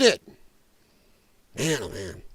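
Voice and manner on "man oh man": low weary groan. Real, not acted. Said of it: "exasperated aside".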